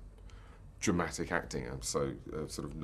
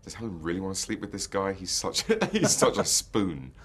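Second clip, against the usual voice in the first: higher, louder, laughing at him; laughing scorn; 4 semitones higher, 7 dB louder than usual. Retold or imitated. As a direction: laugh mockingly at a spoon of a man. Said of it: "'cause I thought".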